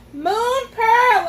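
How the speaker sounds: high, loud cry into the dark woods; loud desperate cry. A narrator's reading. Hurt boy calls the unicorn into the woods, loud cry. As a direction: yell her name with everything left. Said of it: "I cried again".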